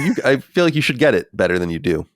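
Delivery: ordinary talk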